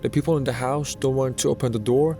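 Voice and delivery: ordinary delivery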